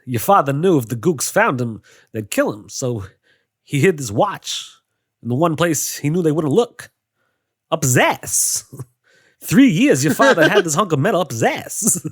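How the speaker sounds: halting celebrity monologue with swooping pitch; broken phrases, pauses in odd places; Christopher Walken. Acted. Friend attempts the watch scene, halting Walken impression. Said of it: "whenever I do try to do a Christopher Walken impression".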